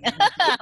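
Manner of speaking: short loud laugh; quick happy laugh; burst of laughter. Real, not acted. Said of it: "Ketawa dia langsung".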